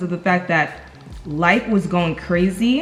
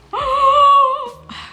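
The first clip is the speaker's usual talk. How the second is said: much higher and louder gasp; a loud, high gasp of surprise; sharp delighted gasp; 6 dB louder than usual. Real, not acted. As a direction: gasp as if you spotted treasure. Reacts to the blush.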